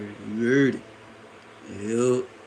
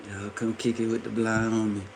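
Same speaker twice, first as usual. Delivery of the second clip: ordinary talk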